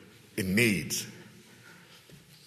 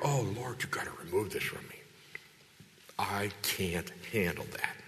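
hushed, pleading whisper, slowing down at the end; desperate whispered prayer; 4 semitones lower, 8 dB quieter than usual. Retold or imitated. Preacher voicing Paul begging God to remove the thorn, hushed pleading whisper. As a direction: breathe it like a broken man begging God.

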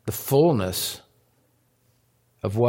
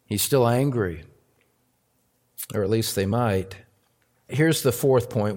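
ordinary talk

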